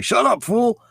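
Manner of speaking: high barked tough-guy catchphrase; funny soundboard voice. Retold or imitated. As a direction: bark it like a cartoon tough guy. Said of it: "like, funny sounds".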